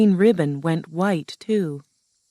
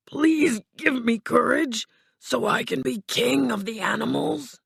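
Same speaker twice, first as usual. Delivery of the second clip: high, timid lion voice; pleading Cowardly Lion; 8 semitones higher than usual. Acted. Lion begs Oz for courage, high pleading voice. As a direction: beg in a scared creature voice.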